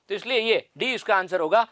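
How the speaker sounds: ordinary talk, nothing marked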